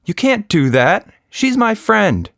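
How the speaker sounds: put-on a girl standing up for her friend; upset child's objection; indignant. Nina defends her friend.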